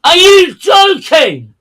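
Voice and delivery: loud, high-pitched outraged question; incredulous shout. Real, not acted. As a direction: bellow it slowly in sheer disbelief. Disbelief at blame on Lewis.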